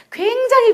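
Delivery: 굉장히 leaping high to underline importance; emphatic stress on 굉장히. Real, not acted. Stressing how important the feature is.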